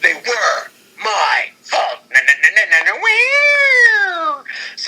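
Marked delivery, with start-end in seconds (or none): 2.1-4.5 a very high, slow squealing wee at the end; playful louder chant ending in a squeal; goofy singsong cheer; silly singsong. Pretend. Silly mock celebration.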